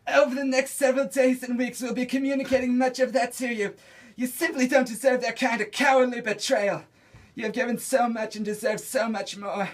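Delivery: high, loud raspy comedienne voice; Joan Rivers impression. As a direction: belt it out like a brash old comedienne. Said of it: "Joan Rivers".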